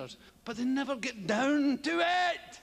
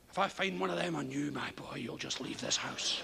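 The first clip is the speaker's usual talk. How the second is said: much lower, low-voiced strict mother; stern mother; 11 semitones lower, 4 dB quieter than usual. Mother warning her son about condoms, stern low voice. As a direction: growl the threat like a stern mum.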